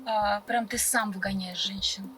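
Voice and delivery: ordinary delivery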